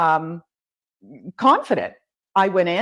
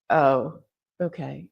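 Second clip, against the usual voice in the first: deflated; 5 semitones lower, slower than usual. Retold or imitated. Hearing she failed the exam.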